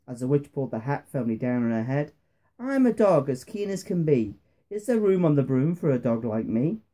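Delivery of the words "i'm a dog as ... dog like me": ordinary delivery, nothing marked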